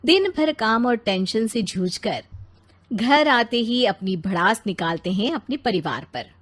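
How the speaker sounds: usual speaking voice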